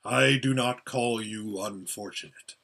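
deep, measured, solemn; calm, weighty creature voice; large voice. A narrator's reading. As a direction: state it gravely as a great being. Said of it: "said the large voice".

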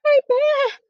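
very high mock scream; screaming Eddie. A narrator's reading. Storyteller voices Eddie seeing the bear, small scream. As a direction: squeal it out in startled recognition. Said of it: "screamed Eddie".

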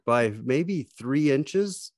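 ordinary delivery

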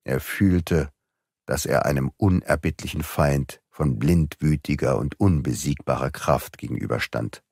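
plain reading voice

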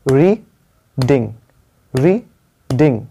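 slow two-beat reading, pause between री and ding; clapping out syllables. Shown as a demonstration. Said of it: "clapping करके बोल सकते हो".

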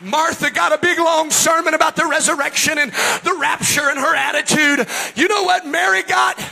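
high, loud revival preacher shout; impassioned pulpit shouting; full-force preaching. Real, not acted. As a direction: preach it at the top of your voice.

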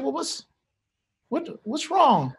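tender, high-pitched coaxing; gentle concern. Retold or imitated. Dad asking his eight-year-old what is wrong, gently concerned.

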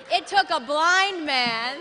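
loud, slow, drawn-out declaration; forceful preaching. Real, not acted. Preacher urging the church to respond, preaching forcefully. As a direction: hammer it home to the congregation. Preacher hammering a repeated line.

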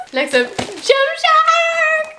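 high, slow hyped-up announcing; excited announcement. Real, not acted. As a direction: call it out like a host. Announcing next package.

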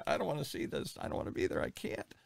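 dropping to a near whisper, voice fading out; hushed refusal. Retold or imitated. Man telling his wife he can't watch, trailing into a whisper. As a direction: say it as if you can barely bring yourself to.

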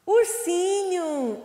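URSINHO high, loud and drawn out; syllable by syllable. Shown as a demonstration. Teacher giving the class the answer, URSINHO slowly sounded out. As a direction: call out URSINHO in long syllables.

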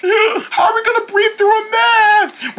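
high, slow gasp and worry; gasping alarm. Acted. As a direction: gasp in horror.

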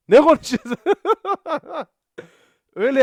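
laughing. Real, not acted. Laughing at own mock German.

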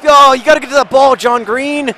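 high, loud pleading pep talk; impassioned full-volume scolding; exasperated shouting. Real, not acted. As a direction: yell at your star player to step up. Yelling at his player mid-match.

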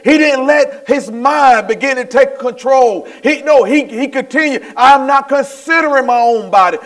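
high, forceful sermon run; impassioned preacher in full flow; fervent preaching. Real, not acted. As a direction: pile up phrase on phrase with mounting conviction.

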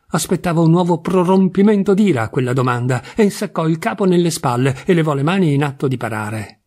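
ordinary delivery, nothing marked

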